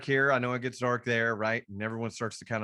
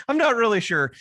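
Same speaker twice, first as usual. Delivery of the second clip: talking through a laugh, pitched up; 10 semitones higher, 9 dB louder than usual. Real, not acted. Speaker amused at why that happens to them, laughing. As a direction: burst out louder and higher, laughing. Laughs at own unexplained luck.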